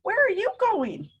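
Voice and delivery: a small bird calling out curiously. Retold or imitated. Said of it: "this little bird right here saying".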